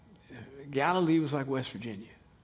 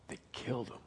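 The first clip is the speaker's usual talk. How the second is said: hushed whisper; 6 semitones lower, 11 dB quieter than usual. Real, not acted. Preacher telling the congregation of the killing, whispered. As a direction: drop to a whisper for weight. Grave point about the murder.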